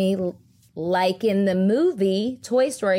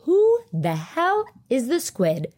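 emphatic, drawn-out question, only moderately raised; retold demanding question; 5 semitones higher, slower than usual. Asking Lisa who the squid in the bathroom is, exasperated. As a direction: ask slowly with exasperated weight on "Who the hell". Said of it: "I was asking Lisa".

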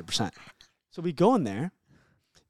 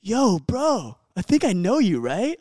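higher, louder mock of a cocky party guy; imitating the guy's tone; 8 semitones higher, 5 dB louder, slower than usual. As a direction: echo his overly friendly bro greeting. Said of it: "In the same act, tone, too".

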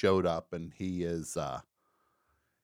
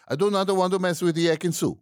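high, fast, loud mock Italian accent; 11 semitones higher, 7 dB louder, faster than usual. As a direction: rattle it off like an Italian islander. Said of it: "this Italian accent".

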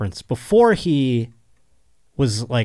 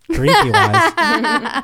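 high, slow laugh through the words; 5 dB louder, much slower than usual. Real, not acted. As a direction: giggle it out, stretched. Joking about her drinking.

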